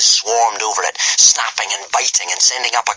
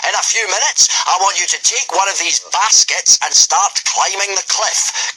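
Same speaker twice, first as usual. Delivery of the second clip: commanding Gobber; 11 semitones higher than usual. A narrator's reading. Gobber sending the boys up the cliff, commanding. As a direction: order the boys like a drill master. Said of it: "commanded Gobber the Belch".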